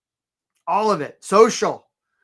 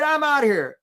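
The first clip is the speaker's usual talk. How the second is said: crab leaving; 8 semitones higher, 3 dB louder than usual. Retold or imitated. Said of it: "he's like".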